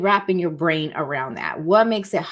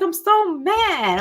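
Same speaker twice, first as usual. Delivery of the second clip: high, drawn-out mock complaint; mock mad client; 10 semitones higher, much slower than usual. Client complaining to the coach about feelings, mock mad. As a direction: imitate a worked-up client, stretching it out. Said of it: "I work with people the most".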